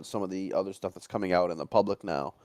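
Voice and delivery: usual speaking voice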